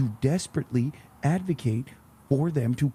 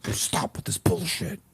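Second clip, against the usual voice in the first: unvoiced, higher whisper scream; 7 semitones higher than usual. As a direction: yell it under your breath. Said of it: "I had to be whisper screaming".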